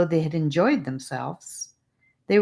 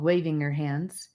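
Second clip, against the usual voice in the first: ordinary delivery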